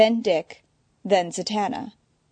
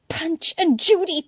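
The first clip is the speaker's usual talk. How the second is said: muttering; 10 semitones higher than usual. Acted. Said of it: "now muttering".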